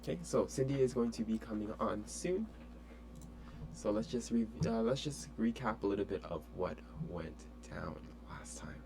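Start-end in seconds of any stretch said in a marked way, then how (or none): 4.7-8.8 unvoiced whispered recap; hushed aside. Real, not acted. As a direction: whisper it to the camera before the student arrives. Whispered recap before student joins.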